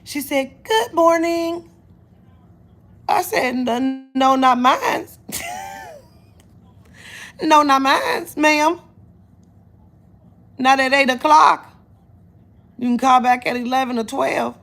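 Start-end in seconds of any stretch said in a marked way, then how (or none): 0.6-1.7 high, drawn-out, loud phone greeting; caller's loud greeting. Retold or imitated. Caller greeting her on the phone, mimicked loud and high. 3.5-14.6 loud, high telling-off of a caller; indignant retort. Retold or imitated. Refusing an early morning caller, loud and indignant.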